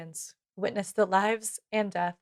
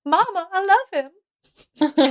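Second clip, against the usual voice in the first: high, slow, drawn-out Southern wail; The Notebook Southern accent; 10 semitones higher, 5 dB louder, much slower than usual. Shown as a demonstration. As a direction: ham up a Southern belle, loud and high. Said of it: "my favorite Southern accent to do is The Notebook".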